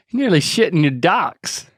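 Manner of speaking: normal talking voice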